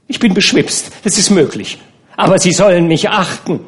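high, loud and defiant; indignant insistence. A narrator's reading. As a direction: demand respect, tipsy and stubborn. Tipsy Nana insists on respect. (beschwipst, trotzig und laut)

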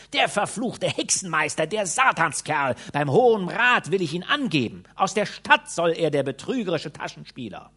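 loud, high angry shouting; shouting crowd. A narrator's reading. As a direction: yell it out in outrage. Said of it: "So schrien die Leute durcheinander".

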